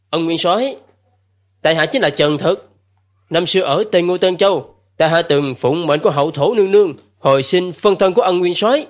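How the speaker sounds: calling out. Acted. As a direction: call up politely to the god. Calling up to the god.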